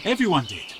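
emphatic insistence. Acted. Villager insists angrily.

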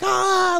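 high-pitched imitated scream; mock scream. Storyteller imitating teens on the train, high mock scream. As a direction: imitate screaming kids in a thin high cry. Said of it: "they were screaming".

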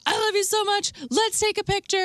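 high-pitched mock fan gushing; imitating gushing fan. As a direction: gush like a starstruck, hammered fan. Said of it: "was like".

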